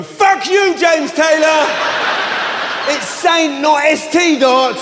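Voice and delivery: high, loud mock yell of outraged locals; angry crowd shout. Angry locals yelling at [name] online, loud mock shout. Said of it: "thousands of people are going".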